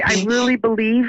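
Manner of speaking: ordinary talk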